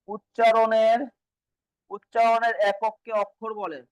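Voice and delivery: slow, measured reading-out for note-takers; slow dictation. Shown as a demonstration. Teacher telling students what to write, slow dictation. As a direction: spell out the definition at writing speed.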